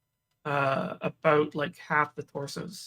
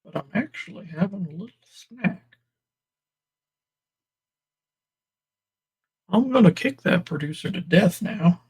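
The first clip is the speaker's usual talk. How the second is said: mouth full of peanut butter; 2 semitones higher than usual. Pretend. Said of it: "Producers put peanut butter in my mouth".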